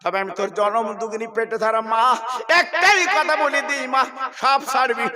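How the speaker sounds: loud, slowed final charge; mother's solemn command, preached at full force; earnest preaching. Acted. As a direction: drive home the last command.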